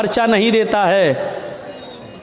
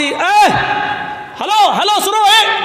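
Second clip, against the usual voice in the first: calling out; 12 semitones higher, much slower than usual. Retold or imitated. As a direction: call after someone walking away. Calling out to leaving man. (اونچی آواز میں پکارنا)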